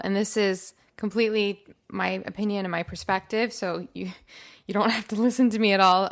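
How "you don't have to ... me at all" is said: laughing. Real, not acted. Laughs at own disclaimer.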